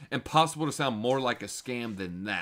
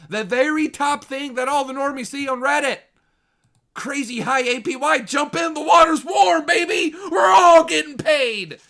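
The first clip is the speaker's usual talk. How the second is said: shouted, much higher hype-man voice; mock crypto shill; 12 dB louder than usual. Streamer mocks the shill post to normies with a shouted, much higher carnival hype. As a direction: yell it like a carnival barker selling a scam.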